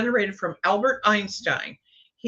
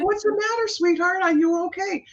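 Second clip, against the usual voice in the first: much higher, gentle coaxing voice; tender call to a child; 11 semitones higher than usual. Retold or imitated. Calling to the crying girl under the table, high and tender.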